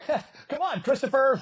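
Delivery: high, pressing "come on"; impatient host. Retold or imitated. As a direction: hurry him along, mock-exasperated. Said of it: "Bob's getting a little like".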